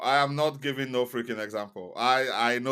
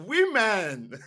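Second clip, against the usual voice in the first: a mock exasperated cry, higher and louder; 9 semitones higher, 4 dB louder than usual. Real, not acted. Teasing his co-host, he exclaims in mock exasperation. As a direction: throw up your hands at women.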